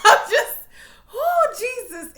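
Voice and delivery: high, loud burst of laughter that trails into a slow sigh; laughing too hard to finish the thought. Real, not acted. Vlogger overwhelmed by the episode, laughing helplessly.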